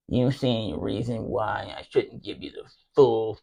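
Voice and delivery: low, soft, prim officer voice; a mild take on a weak, smug officer; nerdy commander. Retold or imitated. Commander asks the accused Marine about punishment, soft and smug.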